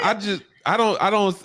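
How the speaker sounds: usual speaking voice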